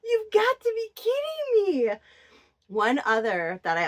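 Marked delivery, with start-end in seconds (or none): 0.0-1.9 much higher, louder "kidding me"; outraged disbelief. Retold or imitated. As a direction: pitch it up in mock outrage. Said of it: "I'm like".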